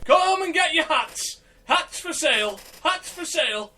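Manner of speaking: high, slow street-seller call; market hawker cry. Pretend. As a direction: call out wares like a market trader. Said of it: "you might hear me going".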